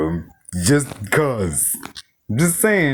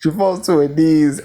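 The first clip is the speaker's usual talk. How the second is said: usual speaking voice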